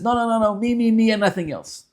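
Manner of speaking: a hint of a petulant soul; greedy soul voice. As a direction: whine like a grabby child. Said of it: "the Nefesh Elokis says".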